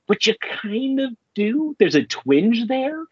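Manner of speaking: ordinary delivery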